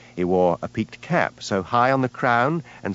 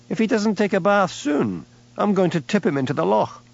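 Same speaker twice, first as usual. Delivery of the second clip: upset sibilant; 10 semitones higher than usual. Acted. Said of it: "accent always became more sibilant when he was upset".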